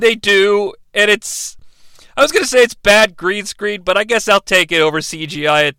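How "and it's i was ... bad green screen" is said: usual speaking voice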